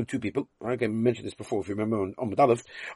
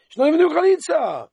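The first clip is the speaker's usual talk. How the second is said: exclaiming; 5 dB louder than usual. Real, not acted. Teacher exclaims at the absurdity.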